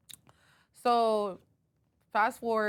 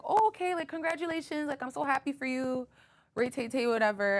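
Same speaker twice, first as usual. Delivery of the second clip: mock fake congratulations; 7 semitones higher than usual. Friend's fake congratulations retold.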